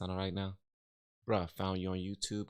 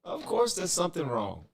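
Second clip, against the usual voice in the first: high, loud frustrated outburst; exasperated; 10 semitones higher, 6 dB louder than usual. Real, not acted. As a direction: throw up your hands in exasperation. Stream audio breaking, exasperated.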